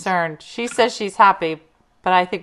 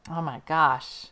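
ordinary delivery

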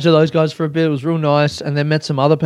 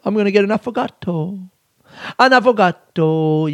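playful affected flourish on affogato; silly, knowingly pretentious; 6 semitones higher than usual. Shown as a demonstration. Podcaster showing listeners how to order affogato, silly and pretentious. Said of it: "You just gotta say it kinda silly".

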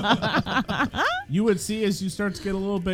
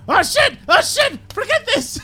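high-pitched panicked kobold yelps; loud, squeaky creature in a flap; freaking out; 7 dB louder than usual. Acted. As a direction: squeal in comic panic, ready to bolt. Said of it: "all freaking out and going".

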